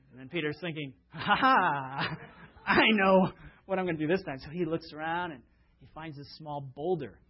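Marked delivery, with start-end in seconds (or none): 1.1-4.4 louder, laughing to himself as he hatches a plan; a sly ha ha, very pleased with his own cleverness; hammed-up smug Peter laughing; scheming chuckle. Peter scheming to outsmart Jesus.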